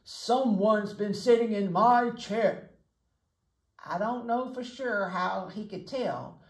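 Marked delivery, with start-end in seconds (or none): 0.0-2.7 slow, louder indignant bear voice; a father bear discovering an intruder, drawn out; Papa Bear. Acted. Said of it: "said Papa Bear".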